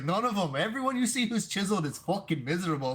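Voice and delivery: high, loud hammed-up Irish brogue; Irish accent impression. Fighter ranting about chiseled guys, in mock Irish accent. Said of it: "hard Irish accent".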